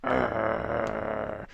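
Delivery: loud grunting roars; mock ape brawl noises; ape grunts. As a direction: growl like fighting meatheads. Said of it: "the two alphas are like".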